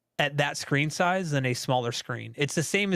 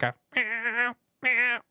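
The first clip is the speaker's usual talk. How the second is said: cat meow; 9 semitones higher, much slower than usual. Retold or imitated. Imitating cats.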